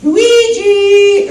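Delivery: high, loud sung call; calling Luigi. Retold or imitated. Mario calling for his brother, a high loud sung call. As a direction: sing his name out to the kitchen. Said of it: "I'd turn my head and I'd say".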